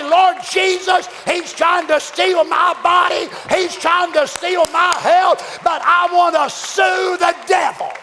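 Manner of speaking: shouted courtroom prayer against the devil; pleading prayer. Pretend. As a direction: cry out to Jesus like in court. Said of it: "take the devil to court and say".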